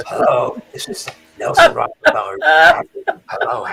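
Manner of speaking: raspy politician impression; comic impression with a rasping hello; Nelson Rockefeller. Shown as a demonstration. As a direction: put on a husky, mostly voiceless caricature. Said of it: "I could do Nelson Rockefeller".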